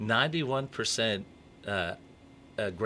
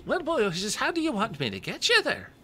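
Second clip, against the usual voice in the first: put-on very high, louder squeaky old elf; leprechaun voice; 14 semitones higher, 3 dB louder than usual. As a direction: pitch way up into a comic leprechaun. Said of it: "great leprechaun voice".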